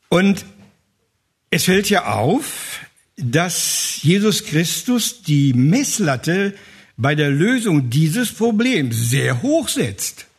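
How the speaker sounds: ordinary talk, nothing marked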